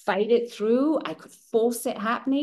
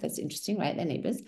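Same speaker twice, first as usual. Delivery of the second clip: ordinary talk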